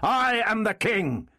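high, slow, emphatic declaration; aggressive. A narrator's reading. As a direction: assert the crown forcefully, each word drawn out. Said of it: "he said aggressively".

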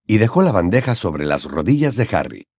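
ordinary delivery

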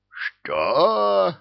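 high, rising bellow; drawn-out outraged yell; roared outrage. A narrator's reading. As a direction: roar the word in disbelief. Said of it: "взревел Одуван".

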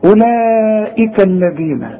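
measured recitation; Quranic recitation. Shown as a demonstration. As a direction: recite it slowly. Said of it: "كأن الواو غير موجودة".

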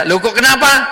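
high, startled acted query; puzzled mock surprise; surprised question. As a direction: ask it taken aback, pitch jumping up. Acted passer-by questions weeping mother.

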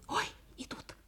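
quick hushed alarm; startled whisper. Acted. Fox seeing the hunters return, startled whisper. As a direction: gasp it under your breath. Fox spots them coming.

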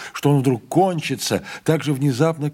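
plain reading voice

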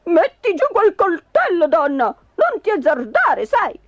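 high, panicky order; high-pitched, urgent husband; alarmed warning. Acted. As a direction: warn her off the knife in alarm. Husband alarmed by wife's knife.

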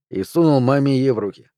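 plain reading voice

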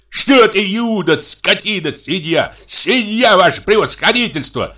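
high-pitched, indignant tirade; quarrelsome brother ranting. A narrator's reading. Furious brother scolds in story. (скандалист, высокий негодующий голос)

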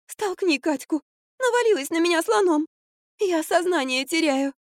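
high, whiny feeble voice; plaintive babble. A narrator's reading. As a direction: plead as if about to faint. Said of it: "залепетала Марфа". (жалобный высокий лепет)

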